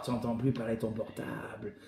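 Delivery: ordinary delivery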